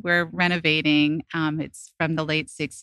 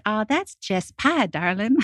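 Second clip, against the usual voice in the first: lightly imitated Southern drawl, slower; a hint of a sweet Southern cook's accent, slowed down; 4 semitones higher than usual. Telling the hosts about the Southern cook, a light Southern accent. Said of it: "in her little Southern accent".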